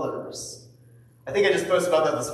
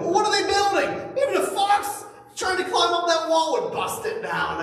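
high, jeering tone; mocking taunt; 12 semitones higher than usual. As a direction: sneer at the wall like an enemy. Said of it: "here's a G-rated one".

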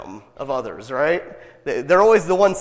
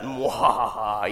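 evil laugh; 6 semitones lower, slower than usual. Retold or imitated. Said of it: "that evil villain".

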